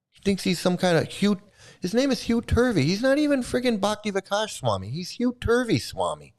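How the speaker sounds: high-pitched, outraged ranting; incredulous rant. Real, not acted. Vlogger ranting to viewers about the swami, high and outraged. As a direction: pour out disbelief at this guy.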